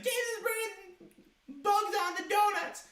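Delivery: high, slow squeaky tattletale voice; tattling kid. Retold or imitated. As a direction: tattle in a shrill little kid voice. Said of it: "the teacher was like".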